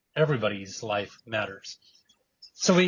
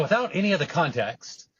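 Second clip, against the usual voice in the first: ordinary talk, nothing marked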